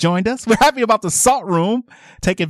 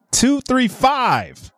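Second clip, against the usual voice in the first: slow spelled-out digits; 5 semitones higher, much slower than usual. Real, not acted. Reading the number slowly to caller.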